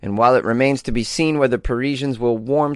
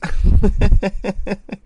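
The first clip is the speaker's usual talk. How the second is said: high laugh; 7 semitones higher, 4 dB louder than usual. Real, not acted. After the Starbucks joke, a high laugh.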